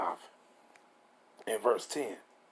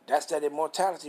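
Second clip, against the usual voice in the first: usual speaking voice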